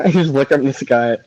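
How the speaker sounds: ordinary talk, nothing marked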